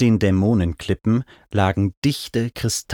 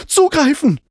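high, loud, urgent yell; shouted command; 6 dB louder than usual. A narrator's reading. Narrator orders the mount to grab the climber, urgent shout. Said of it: "schrie ich".

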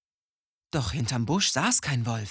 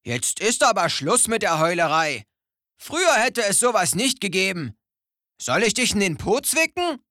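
put-on louder, higher grumpy old stag beetle; stern old beetle; 7 semitones higher, 4 dB louder than usual. A narrator's reading. Old beetle scolding the crying owl, lightly stern. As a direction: scold like a cranky old-timer. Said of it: "sagte streng".

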